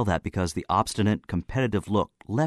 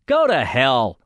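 loud, higher-pitched angry retort; defiant outburst; 5 semitones higher, 7 dB louder, slower than usual. Acted. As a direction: throw it out loudly in defiance. Narrator's imagined defiant retort.

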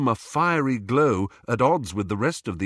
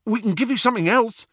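high, loud, rapid eagerness; eager; 14 semitones higher, 6 dB louder, faster than usual. A narrator's reading. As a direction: rush the offer out high and fast. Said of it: "said Ron eagerly".

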